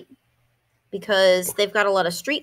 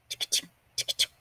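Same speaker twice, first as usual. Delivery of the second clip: fast unvoiced tick-a-tick heartbeat; whispered heartbeat; 8 dB quieter, faster than usual. Cockroach heart beating faster, whispered quickly.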